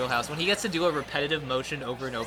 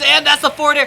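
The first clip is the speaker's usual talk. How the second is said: high, loud excited call; climactic play-by-play; 12 semitones higher, 12 dB louder than usual. Real, not acted. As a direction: shout the deciding hit. Live call of the deciding hit.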